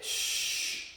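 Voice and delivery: soft, long-drawn count; slow count. Shown as a demonstration. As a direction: stretch the count out slowly and softly. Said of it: "Okay, slowly".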